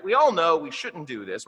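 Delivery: ordinary delivery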